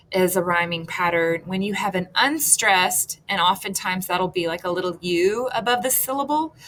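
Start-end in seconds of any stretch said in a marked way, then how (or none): none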